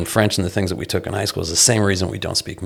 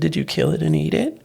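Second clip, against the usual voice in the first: put-on higher voice for a small child; imitated parent-to-toddler; 8 semitones higher, 3 dB quieter than usual. Father asking toddler an absurd question, child-directed sweetness.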